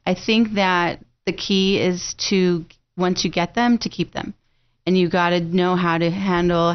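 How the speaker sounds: usual speaking voice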